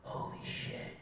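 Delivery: whispered, stunned amazement; awed hush. Acted. Comic walks off after one great night, hushed awe. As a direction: breathe it out in quiet wonder.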